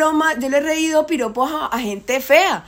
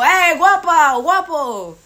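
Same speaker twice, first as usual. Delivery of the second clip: a hint of a catcaller, a bit higher and louder; mock street catcall; 4 semitones higher, 4 dB louder, much slower than usual. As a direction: call out like a guy on the street. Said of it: "no es lo mismo cuando yo paso y digo". (imitación ligera de un piropo callejero)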